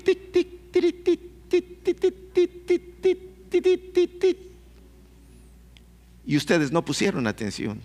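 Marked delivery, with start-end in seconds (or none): 0.0-4.4 high, quick tic tic tic like a telegraph key; light clicking tic sounds; imitated telegraph ticking. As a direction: tap out Morse code with the voice. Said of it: "estaba oyendo tic".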